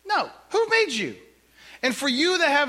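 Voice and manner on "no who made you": exasperated God. Retold or imitated. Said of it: "you can almost hear that and God".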